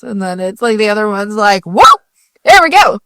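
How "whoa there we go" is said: high, loud perked-up voice; perky imitation. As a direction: play the sudden burst of energy. Mimicking the other perking up.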